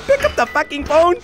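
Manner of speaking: high-pitched panic. Real, not acted. Player begging for help against Foxy, high-pitched panic. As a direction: voice shooting up, frantic. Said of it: "when your voice gets really high-pitched".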